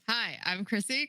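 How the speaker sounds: ordinary talk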